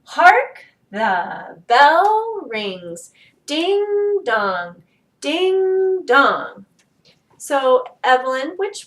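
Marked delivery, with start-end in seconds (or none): 0.0-6.7 "Hark" high and loud, "bell" stretched and punched, "rings" dropping away; slow, stressed reading. Shown as a demonstration. Teacher repeating for careful listeners, stressing "Hark", "bell" and "Ding". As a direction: lean on "Hark", "bell" and each "Ding", like poetry. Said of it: "Some words have more stress than others".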